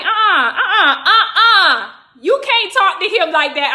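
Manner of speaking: high, loud mock protest; loud objection. Bystander standing up for the snake man to a cop, loud objection.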